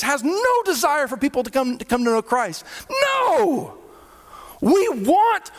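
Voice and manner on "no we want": sharp, much higher cry of no; strong high protest; emphatic no. Real, not acted. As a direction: cut the idea off hard. Emphatic rebuttal of a misconception.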